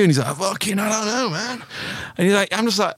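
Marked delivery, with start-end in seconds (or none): 0.4-1.6 high, softening shrug of a boast; imitated humblebrag in a high voice; mock bafflement. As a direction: play the baffled braggart, pitched up. Acts out boaster's false bafflement.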